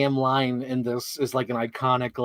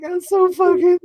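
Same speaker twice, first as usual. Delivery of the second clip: high, loud, drawn-out fake wail; hammed-up sobbing misery; mock crying; 7 dB louder, slower than usual. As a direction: imitate a blubbering man, pitched way up. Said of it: "just crying in the, by the pool".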